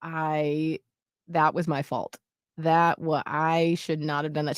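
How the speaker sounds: low, soft and halting; calm apology. Retold or imitated. Mom apologising to her daughter, calm. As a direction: re-enact a measured, sincere apology with pauses. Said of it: "I calmly, I was like".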